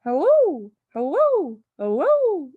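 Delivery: high, loud squawking hellos; loud parrot call. Acted. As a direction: ring out loud and clear like a parrot. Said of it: "a voice came loud and clear".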